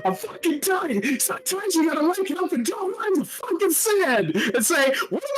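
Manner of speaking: high, fast put-on rant voice; hammed-up impression voice rant; Aaron Hansen mix. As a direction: rant in a jittery borrowed voice. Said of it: "a mix of Aaron Hansen and just go".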